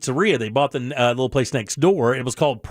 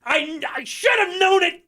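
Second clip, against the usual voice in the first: mock realization; 13 semitones higher than usual. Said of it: "you're like".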